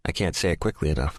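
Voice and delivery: usual speaking voice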